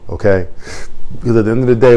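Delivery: normal talking voice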